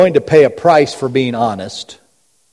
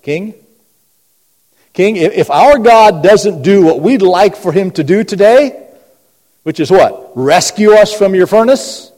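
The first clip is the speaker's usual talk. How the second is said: bold address to the king; 6 semitones higher, 5 dB louder than usual. Acted. Voicing the three addressing the king.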